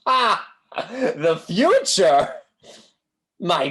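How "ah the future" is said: slow, swelling theatrical cry; dramatic exclamation. Acted. Fortune teller builds suspense before asking for money, theatrical cry. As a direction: unveil the word like a stage magician.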